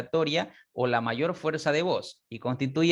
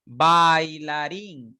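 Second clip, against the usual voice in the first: Bailarín said very slowly and louder; slow model word; 4 dB louder, much slower than usual. Shown as a demonstration. Teacher drawing out the example word. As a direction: stretch Bailarín syllable by syllable. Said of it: "la A se abre más".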